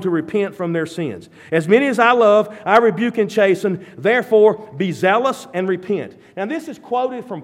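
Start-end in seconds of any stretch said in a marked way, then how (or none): 1.5-6.2 the voice of a rebuking Lord; loud proclamation. Acted. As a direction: declaim it loud and commanding. Preacher proclaims Christ's rebuke.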